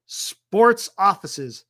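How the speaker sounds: usual speaking voice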